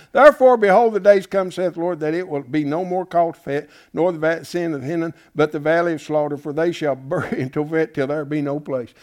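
loud, solemn reading of the verse; scripture reading. Acted. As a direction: proclaim the prophecy to the church. Reads scripture aloud.

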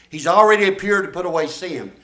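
ordinary talk, nothing marked

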